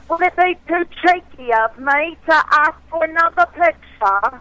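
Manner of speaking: a Southern woman's best shot at a British accent. Shown as a demonstration. Asked by the hosts for her best British accent, a put-on British accent. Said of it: "give us your best British accent".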